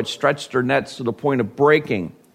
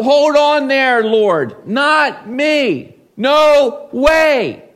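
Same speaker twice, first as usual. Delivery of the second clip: much higher, louder mock protest; indignant protest; 10 semitones higher, 5 dB louder, much slower than usual. Peter refusing Jesus, much higher and louder. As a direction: push back hard on the Lord.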